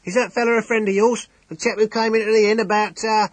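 high, loud, frantic innkeeper voice; overwhelming emotion. Acted. Innkeeper, overwhelmed, blurts his question to Temple. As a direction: gabble it out, shaken and overwrought. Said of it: "his voice almost unintelligible in the sudden pitch of overwhelming emotion".

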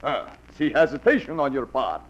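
fake Russian accent. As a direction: play a fake Russian peddler at the door. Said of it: "like the accent, the beard".

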